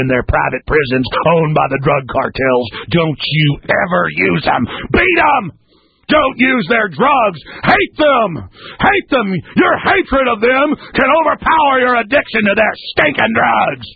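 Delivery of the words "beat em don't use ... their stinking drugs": angry ranting shout; furious shouting. Real, not acted. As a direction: rage at the dealers, full force. Ranting against drug pushers.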